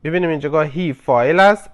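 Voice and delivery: ordinary delivery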